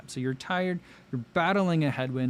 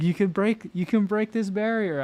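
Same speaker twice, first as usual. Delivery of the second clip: high, pretend pep talk; mock cheering; 8 semitones higher than usual. Pretend pacer urging runners to the finish, mock cheering. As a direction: spur them on with a lifted voice.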